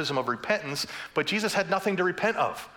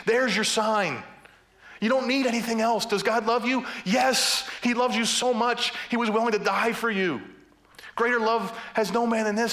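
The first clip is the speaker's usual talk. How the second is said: higher, raised, emphatic sermon delivery; impassioned preaching; 7 semitones higher than usual. Real, not acted. Preacher tells the congregation God loves them, preaching fervently. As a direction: preach it with full conviction.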